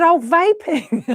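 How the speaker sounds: high, drawn-out dawning realisation; slow, high "oh" of getting it; sudden realisation. Retold or imitated. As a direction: let the penny drop, loud and high. Acted sudden realisation, breaking into laughter.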